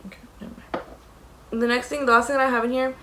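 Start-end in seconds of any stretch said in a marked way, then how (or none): none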